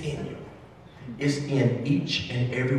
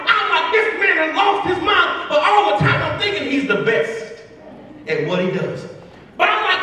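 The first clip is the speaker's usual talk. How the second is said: louder, higher, driving preacher build; fired-up preaching; 14 semitones higher, 4 dB louder than usual. Acted. Speaker to school staff, retelling his mentor lesson, preaching loudly. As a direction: preach it to the back row, full force. Preaching the beach story at full force.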